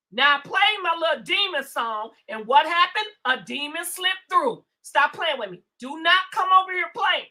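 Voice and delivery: ordinary delivery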